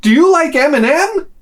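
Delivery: high, eager question; imitated overfriendly brother; happy Norwegian brother. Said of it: "the first thing he says to me".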